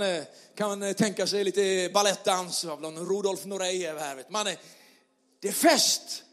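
loud, joyful preacher's call; festive preaching. Acted. Pastor rallies the church to celebrate, loud and joyful.

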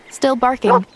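usual speaking voice

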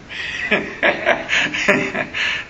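laughing at his own story; hearty laugh. Real, not acted. Preacher amused by a friend's blunt advice, laughing. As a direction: let out a long, amused laugh.

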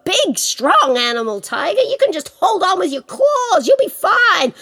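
high, lively mock reassurance; breezy trickster rabbit. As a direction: brush the tiger off brightly. Plays Rabbit brushing Tiger off.

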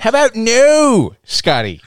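drawn-out high mock quote; quoting Star-Lord. Quoting Star-Lord to cohosts, slow hammed-up line. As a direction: stretch out the no, hamming it up. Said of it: "to quote Star-Lord".

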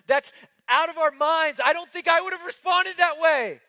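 high, loud, impassioned preaching; rising preacher's cry; preaching at full force. Acted. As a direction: build the sermon to a fever pitch.

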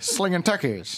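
1920s Chicago mobster, high and laughing; 1920s gangster accent through giggles. Retold or imitated. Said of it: "use that voice".

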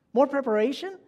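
amazed question to a candle; incredulous echo. Acted. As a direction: repeat it back as if you can't believe it. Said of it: "I couldn't believe my ears".

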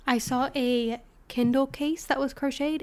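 normal talking voice

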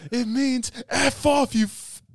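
high, slow acted outburst; angry retort. Retelling the housemate explaining his insult, high drawn-out anger. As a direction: drag out the mock fury.

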